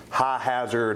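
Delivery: ordinary talk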